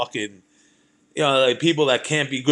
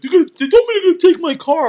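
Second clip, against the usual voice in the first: much higher, louder, fast panic; panicking friend; 7 dB louder, faster than usual. Retold or imitated. Friend panicking in the holding cell, high fast squeal. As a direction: squeal it like he's losing his mind. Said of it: "was losing his mind".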